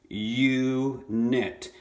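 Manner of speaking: "unit" as two beats, you, nit; split into syllables. Shown as a demonstration. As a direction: hold the U of "unit" apart from nit. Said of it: "that vowel's just by itself".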